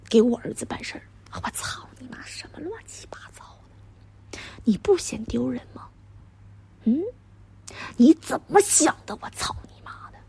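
very soft, half-whispered disbelief; hushed outrage. Acted. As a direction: swear under your breath, appalled. Shocked, keeps it from the driver. (压低声音，又气又难以置信)

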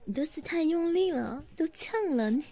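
ordinary talk, nothing marked

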